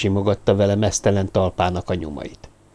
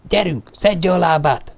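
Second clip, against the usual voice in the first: higher, slow, urgent push; urgent command; 7 semitones higher than usual. A narrator's reading. Lamartine shoves Sophie and urges her to flee, urgent. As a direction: drive her into running.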